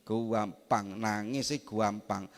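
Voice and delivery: normal talking voice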